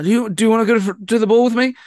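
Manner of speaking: high, loud, awkward ball invitation; Harry Potter. Retold or imitated. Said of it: "Harry asking, uh, Cho out to the ball".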